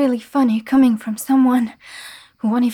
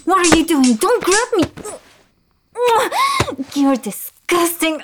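loud, higher, disgusted yelling; outraged shouting; 7 semitones higher, 5 dB louder than usual. Acted. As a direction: push him off with your voice. Thief grabs her.

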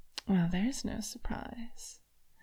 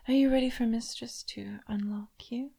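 whisper. Acted. Mistress teasing her toy, now whispered. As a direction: lean in and breathe the question. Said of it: "repeated as a whisper".

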